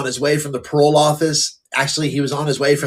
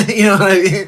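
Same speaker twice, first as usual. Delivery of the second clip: laughing through the question, higher and louder; 7 semitones higher, 6 dB louder than usual. Real, not acted. Laughing at his own joke about sober years, to the hosts. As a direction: chuckle it out as you ask.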